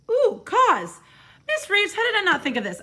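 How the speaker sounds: high, loud burst of discovery; excited. Real, not acted. Sudden discovery of the word.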